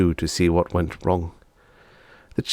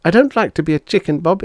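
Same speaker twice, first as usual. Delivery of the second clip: high, fast complaining chicken; a little girl whining; 10 semitones higher, 4 dB louder, faster than usual. Acted. Bonnie complaining to Bob, high fast voice.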